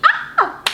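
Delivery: very high squeal of excitement; excited squeal. Real, not acted. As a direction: squeal like a starstruck fan. Excited about meeting Safari.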